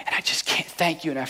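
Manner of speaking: retelling her thanks in a soft, teary whisper; tearful whisper. Retold or imitated. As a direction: breathe it out like a woman too moved to speak. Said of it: "She's crying".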